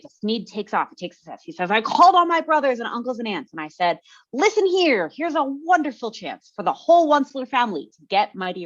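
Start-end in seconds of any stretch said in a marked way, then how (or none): 1.7-8.7 raised, eager salesman's pitch to his family; voiced Once-ler quote. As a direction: sell the family on getting rich.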